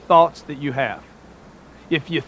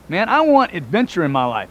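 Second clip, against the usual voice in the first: ordinary talk, nothing marked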